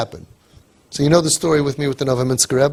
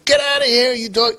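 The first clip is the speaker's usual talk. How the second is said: high, sharp shout to drive a dog off; hostile shout at a dog; 9 semitones higher, faster than usual. Pretend. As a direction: yell it at a big dog at your door. Shooing a big dog away.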